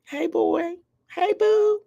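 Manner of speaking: put-on high, bright hey girl greeting to yourself; cheery greeting. Shown as a demonstration. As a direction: greet yourself sweetly in the mirror. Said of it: "Start with that initial".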